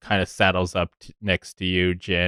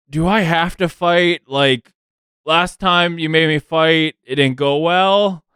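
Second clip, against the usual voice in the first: a hint of a higher, whiny orc; slightly higher, pleading orc asking not to fight; timid orc voice; 7 semitones higher than usual. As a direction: plead like a reluctant big kid.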